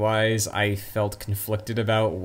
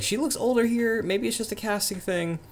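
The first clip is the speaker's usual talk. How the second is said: high-pitched thought voice; 13 semitones higher than usual. Retold or imitated. Retold own thought in theater.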